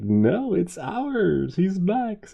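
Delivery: high, loud reassurance; acted upbeat protest; cheery insistence. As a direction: sell the new dog to a doubting wife. Husband insists to upset wife.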